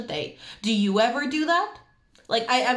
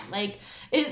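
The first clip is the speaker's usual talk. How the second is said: usual speaking voice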